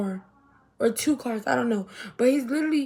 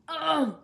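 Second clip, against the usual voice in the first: exasperated "ugh", pitched up; annoyed groan; 6 semitones higher than usual. Retold or imitated. Retelling her reaction to her cousin, exasperated groan.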